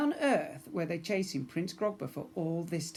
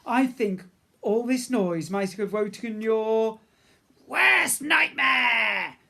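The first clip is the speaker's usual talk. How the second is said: ominous warning; 7 semitones higher, 9 dB louder, slower than usual. Acted. Nancy warns.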